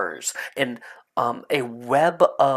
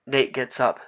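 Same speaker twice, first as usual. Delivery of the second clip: normal talking voice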